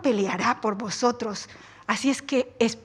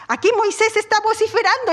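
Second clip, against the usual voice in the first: ordinary delivery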